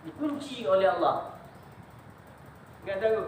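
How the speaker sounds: ordinary delivery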